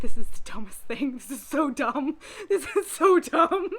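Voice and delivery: laughing through it, stretched at the end; laughing disbelief. Retold or imitated. Reader laughing at the dumbest plot twist. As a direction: crack up saying how dumb it is. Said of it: "I was like".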